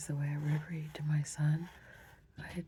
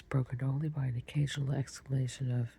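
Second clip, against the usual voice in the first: usual speaking voice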